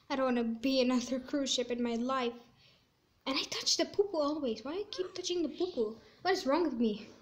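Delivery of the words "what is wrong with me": ordinary talk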